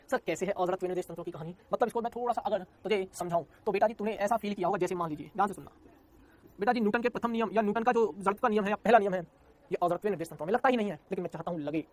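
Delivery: normal talking voice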